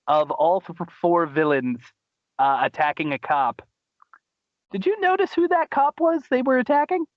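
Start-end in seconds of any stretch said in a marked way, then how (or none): 4.7-7.0 much higher, thrilled question; bursting with excitement; excited. Real, not acted.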